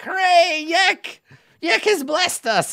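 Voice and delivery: very high, loud goblin cheer; squealing goblin worship. Acted. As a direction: cry it out like ecstatic little goblins. Goblins cheering their lord.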